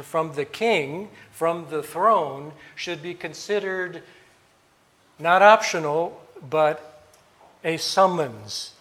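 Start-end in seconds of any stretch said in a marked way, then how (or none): none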